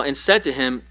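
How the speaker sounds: normal talking voice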